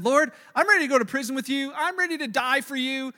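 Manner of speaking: loud, high, fervent vow; bold vow. Retold or imitated. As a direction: declare it with all your chest. Peter's bold vow, retold.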